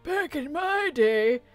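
put-on old man voice. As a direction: put on a grumpy grandpa voice. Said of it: "my old man voice".

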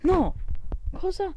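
high, loud sudden no; shocked shout. Real, not acted. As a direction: yelp in disbelief. Sudden game death.

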